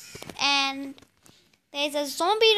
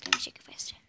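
hushed whisper while sneaking; 6 dB quieter, faster than usual. Real, not acted. Player whispering while sneaking through a cave. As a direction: breathe it with no voice.

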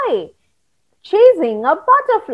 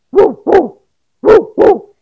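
mock dog barking; 6 dB louder than usual. Dog barks.

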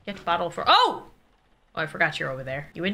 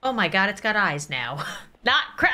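ordinary talk, nothing marked

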